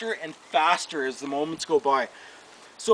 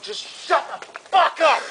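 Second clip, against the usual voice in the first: high, slow, emphatic retort; retold defiant outburst; 7 semitones higher than usual. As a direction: act out standing up to your uncle. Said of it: "first time I ever really stood up to him".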